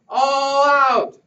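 loud high wake-up call; shout. Acted. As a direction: roust the fellas out of bed. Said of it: "I shouted".

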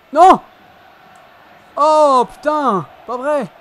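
loud angry outcry at the game; frustrated shout. Real, not acted. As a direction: yell out in dismay. Goal conceded, player's frustrated outburst.

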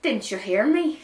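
high, loud, insistent question; indignant storybook shout. A narrator's reading. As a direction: demand to be heard. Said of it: "she shouted".

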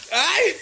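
very high short "ai"; sudden squealed ouch; startled yelp. Real, not acted. Friend interrupted while filming the bath, startled yelp.

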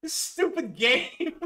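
hammed-up furious outburst at the game; mock rage shout. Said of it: "the part of him fucking swearing".

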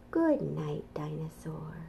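soft bedtime whisper; closing the bedtime book in a hush. A narrator's reading. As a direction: whisper it like he's asleep. Reading bedtime story ending.

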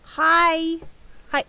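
high loud cheerful "Hi!"; excited greeting. Real, not acted. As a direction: call out hi with delight. Greeting a friend's stream.

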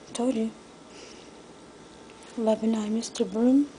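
ordinary talk, nothing marked

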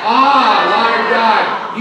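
loud, high, impassioned call to God; emotional cry. Shown as a demonstration. Preacher getting church to repeat Jeremiah's words, emotional cry. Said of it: "you gotta put some emotion in this".

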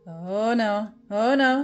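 usual speaking voice

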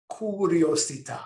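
curiosità said the Italian way, kur-ee-oh-zee-TAH, stress on the end; Italian word. Shown as a demonstration. Teacher gives the Italian word for curiosity, Italian pronunciation. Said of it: "In Italian".

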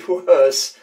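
normal talking voice

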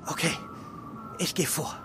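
low, soft lead; hushed. Acted. Detective whispering to partner on the stairs, hushed. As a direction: murmur so the thief won't hear. Sneaking upstairs, leading the way.

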